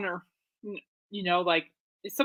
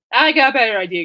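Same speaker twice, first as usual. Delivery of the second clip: "I got a better idea" said again, louder and more emphatic; louder repeat; 2 semitones higher, 9 dB louder than usual. Shown as a demonstration. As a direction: push "I got a better idea" out with more energy the second time.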